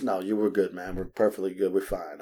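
low, soft, easygoing; imitated laid-back guy. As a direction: reassure lazily in a low, quiet voice. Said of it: "He was laid back".

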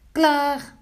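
high, loud announcement; calling out. A narrator's reading. Pinkeltje announces the pancake is ready, high loud call. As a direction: sing out that it's ready. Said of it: "riep Pinkeltje".

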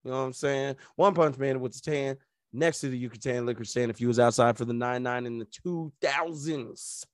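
ordinary talk, nothing marked

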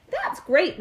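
bright cry of glee; gleeful. A narrator's reading. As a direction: cheer it out like a happy child. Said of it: "cried with a glee".

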